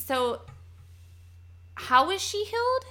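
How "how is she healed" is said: incredulous. Real, not acted. Listener baffled by plot.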